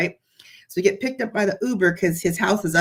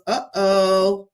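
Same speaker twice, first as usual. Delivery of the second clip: uh-oh; 4 dB louder than usual. Retold or imitated. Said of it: "like that".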